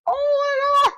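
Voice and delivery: high shocked cry of oh my God; retold panic, squealed high and loud; horrified shriek. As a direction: shriek it in horror. Panicked apology after hitting guy.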